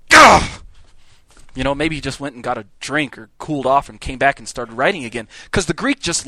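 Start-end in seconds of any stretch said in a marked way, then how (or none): 0.1-0.6 frustrated outburst. Retold or imitated. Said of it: "and he's like".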